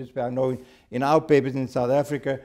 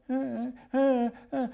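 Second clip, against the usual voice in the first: high imitated dog whimpers; 11 semitones higher, slower than usual. Storyteller imitating the dog at the seance, whimpering. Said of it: "there were some whimperings of a dog".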